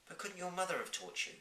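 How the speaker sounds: put-on young girl voice. Sophie asks.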